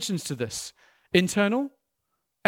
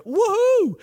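high, loud hammed-up whoop of joy; a pretend crowd cheering "Woohoo!"; mock cheer; 12 semitones higher, 6 dB louder than usual. Preacher acting out the church cheering, a high loud mock whoop. Congregation imagined cheering the Spirit.

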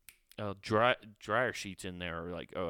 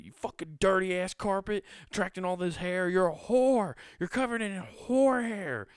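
high-pitched screeching curses at the grout; angry cleaner rant; 10 semitones higher, 6 dB louder than usual. Pretend. Pretend angry cleaner yelling at the moldy grout, hammed-up fury. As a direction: scream abuse at the grout like it wronged you. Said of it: "you're yelling at your carpet".

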